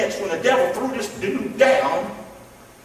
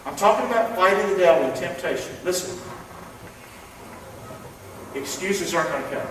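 ordinary delivery

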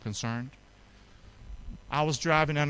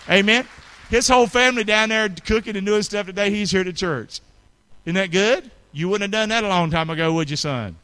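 louder joyful praise; loud preaching; 6 semitones higher, 8 dB louder than usual. Real, not acted. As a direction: cheer the congregation on for Mitch. Preacher praises Mitch loudly.

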